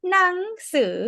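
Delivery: หนังสือ in its conversational tone variant; alternative conversational tone. Shown as a demonstration. Teacher shows learner the conversational version, alternative tone. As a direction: say หนังสือ with the everyday tone change. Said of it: "can also be said as".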